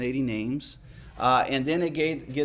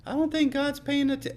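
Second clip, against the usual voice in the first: 1 mock doubting; 12 semitones higher than usual. Feigned doubt.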